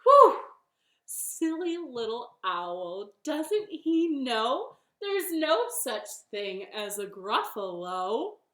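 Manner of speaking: relieved high whew, then loud drawl; smug bunny. Acted. Bunny mocks owl after fooling him, smug. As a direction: stretch the words in mock pity. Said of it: "And the bunny said".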